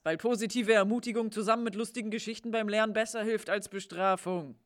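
normal talking voice

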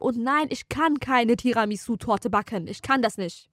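ordinary talk, nothing marked

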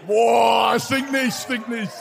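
surprised shout. Real, not acted. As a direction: shout it out amazed. Sniffing shoe on stage.

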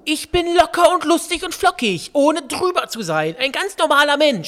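high, loud, insistent protest; aggressive outburst. Real, not acted. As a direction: defend yourself at full volume. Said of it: "Bennys Aggressionsproblem". (aggressiver, lauter Ausbruch)

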